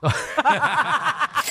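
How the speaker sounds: high amused laugh; real laughter. Real, not acted. Host laughs at joke about what he pays, high laughter.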